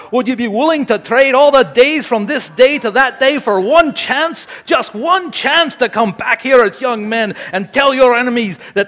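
loud, high rallying cry; shouted battle speech. A narrator's reading. Speaker as Wallace rousing his men to fight, full-force shouted rally. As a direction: roar it to an army, building to a drawn-out final shout. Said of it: "Wallace shouted back".

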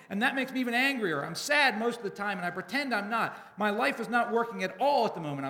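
loud, high fed-up outburst; exasperated confession at full volume; frustrated yell. A narrator's reading. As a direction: yell it out so the whole room hears. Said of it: "I yell out so everyone can hear".

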